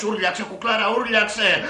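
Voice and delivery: high, loud mock urging; hammed-up man in a frenzy; eager film lover. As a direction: egg her on like an overexcited lover. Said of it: "Αυτός".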